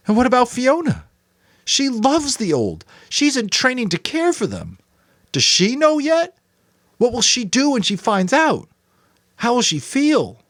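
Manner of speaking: put-on a boy near tears, crying out; upset boy, high and raw; Jonas, anguished. A narrator's reading. Jonas about Fiona.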